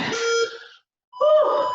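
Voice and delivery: a loud, high gasp of relief after the set; real winded whew. Real, not acted. Instructor catching her breath after fast feet, winded whew. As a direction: blow out hard, spent from the squats.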